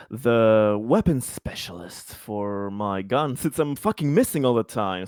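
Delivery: ordinary talk, nothing marked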